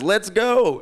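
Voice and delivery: high-pitched imitation of an instructor's go call; Russell. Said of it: "then he says".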